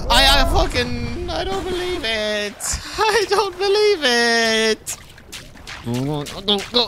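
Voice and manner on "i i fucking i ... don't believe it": high, laughing cry of disbelief; high-pitched stunned wail; disbelieving wail. Real, not acted. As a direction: shriek that it cannot be happening. Disbelief after falling.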